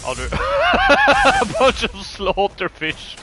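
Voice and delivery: laughing. Real, not acted. Sees many enemy fish.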